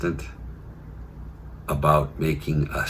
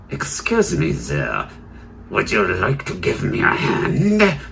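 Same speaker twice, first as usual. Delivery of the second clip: mock German accent. Surgeon politely asking a cleaner for help, in mock German accent. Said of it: "It would be more like".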